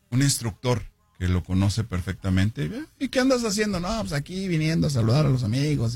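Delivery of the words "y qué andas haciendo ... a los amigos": high-pitched acted small talk; lightly performed high-voiced chat; retold greeting exchange. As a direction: act out the friendly greeting, pitched up. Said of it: "y llegó [name]".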